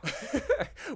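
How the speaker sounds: light self-amused laugh; short chuckle. Real, not acted. Host joking about being a dum-dum, short chuckle. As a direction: chuckle briefly at yourself.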